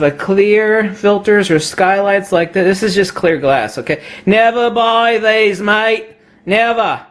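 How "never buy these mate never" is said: higher, slow mock Aussie warning; Australian accent. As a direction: warn them off in a put-on Australian accent. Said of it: "my use of an Australian accent".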